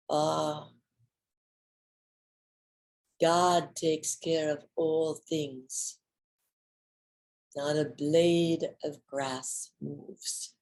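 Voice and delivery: a gentle, unhurried pronouncement; saintly Swami. Retold or imitated. Swami speaks to her over the grass, slow and soft. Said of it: "when he turns and says".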